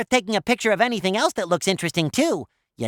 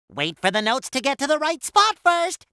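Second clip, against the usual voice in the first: ordinary talk, nothing marked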